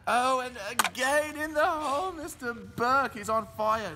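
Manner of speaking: rising excited announcer call. Pretend. Announcer hyping a putt dropping in. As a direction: sell the sinking putt with a lift.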